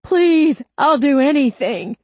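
high, loud bargaining plea; desperate begging. A narrator's reading. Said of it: "Wright begged".

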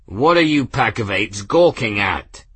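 loud commanding snap at the crew; authoritative roar. Acted. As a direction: roar at the gawking men. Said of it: "raised his voice to an authoritative roar".

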